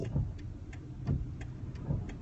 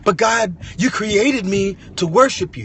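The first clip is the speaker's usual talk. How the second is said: much higher, louder pleading to God; insist, voice raised, that you're giving everything; pleading protest; 8 semitones higher, 6 dB louder than usual. Acted. Believer protesting loudly to God that he sings for him.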